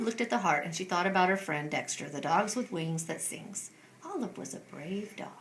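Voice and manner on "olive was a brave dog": whisper. A narrator's reading. Narration drops to whisper.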